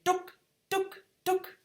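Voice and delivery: put-on very high little toddler voice; imitating toddler. Mum imitating her son's few words, very high toddler voice. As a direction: squeak it like a baby repeating a word. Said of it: "him just saying these words".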